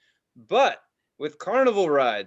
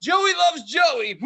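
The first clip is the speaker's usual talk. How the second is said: high, loud and slow shouted chant; to grandmother, a dazed loud shout; shouted mantra; 12 semitones higher, 7 dB louder, much slower than usual. Retold or imitated. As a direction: yell the phrase at grandma in a daze. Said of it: "And I was like".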